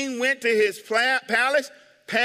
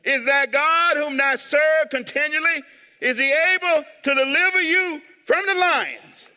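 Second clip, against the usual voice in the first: lamentable cry; 7 semitones higher, 4 dB louder than usual. Acted. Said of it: "in a lamentable voice".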